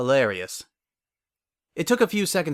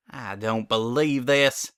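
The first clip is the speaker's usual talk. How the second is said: fuming; slower than usual. A narrator's reading. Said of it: "Applejack fumed".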